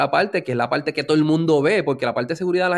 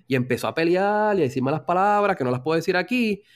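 ordinary delivery

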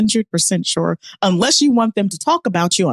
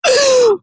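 loud sharp gasp up high; shocked gasp; 5 dB louder than usual. Real, not acted. Shocked at being mistaken.

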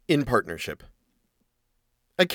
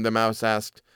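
ordinary delivery, nothing marked

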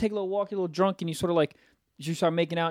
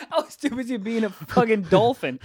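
laughing while joking; 4 semitones higher than usual. Real, not acted. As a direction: laugh through the line.

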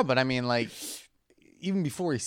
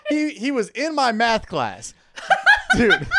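laughing; 11 semitones higher, 7 dB louder, slower than usual. Real, not acted. Laughing disbelief.

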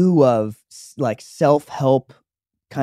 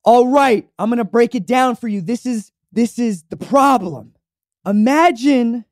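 loud, higher motivational speaker voice; Steve Harvey voice; 7 semitones higher, 3 dB louder than usual. Retold or imitated. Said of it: "he's speaking to the crowd and he's like".

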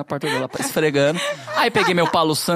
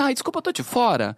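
voz de mulher afetada, bem mais aguda; mock prissy woman; 7 semitones higher than usual. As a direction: imita a administradora metida, voz aguda e rápida. Imitating the agency woman. (imitação afetada e aguda da mulher da administradora)

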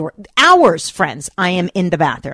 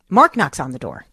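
normal talking voice

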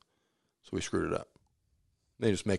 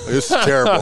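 mock outburst; 13 semitones higher, 8 dB louder than usual. Tasting bad pastrami, telling buddy.

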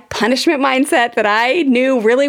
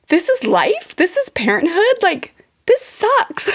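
exasperated; 10 semitones higher than usual. Retold or imitated. Retold despair at parenthood.

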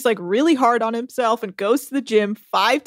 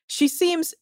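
excited; 4 semitones higher, 5 dB louder, slower than usual. Real, not acted. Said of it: "So I'm excited for that".

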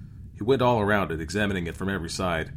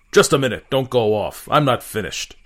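loud, higher, halting call; captain calling someone back loudly; commanding captain; 5 semitones higher, 10 dB louder than usual. A narrator's reading. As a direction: stop them with a sharp order. Captain halts departing Optus.

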